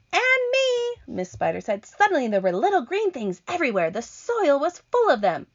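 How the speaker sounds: high, quick spider voice; Miss Spider. Acted. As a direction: chatter eagerly.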